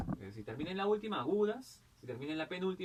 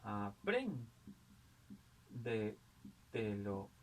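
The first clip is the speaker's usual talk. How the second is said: very slow, lower "Apréndetelo", broken A-PRÉN-DE-TE-LO; syllable by syllable; 5 semitones lower, 4 dB quieter, much slower than usual. Shown as a demonstration. Teacher dividing the example for pupils, "Apréndetelo" slowly syllable by syllable. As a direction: sound out "Apréndetelo" in separate chunks with long gaps. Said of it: "anterior a antepenúltima".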